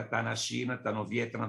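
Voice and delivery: ordinary delivery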